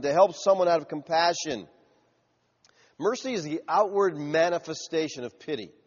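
ordinary talk

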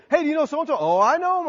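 high, loud retold call; acted-out lively recognition; animated phone exchange. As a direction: play both callers briskly. Acts out church phone call.

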